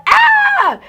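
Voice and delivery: very high, loud shriek; long high cry of retold fright; mock scream. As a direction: scream in acted-out terror. Retold scream atop the car.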